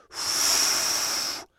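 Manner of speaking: long unvoiced blow through the lips; blowing into wand. A narrator's reading. Fífa and the fairy blowing bubbles, unvoiced blow. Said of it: "Společně do bublifuku foukli".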